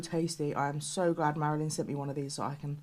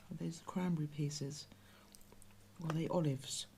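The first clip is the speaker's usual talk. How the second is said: whispering; 10 dB quieter than usual. Real, not acted. Nervous about tasting.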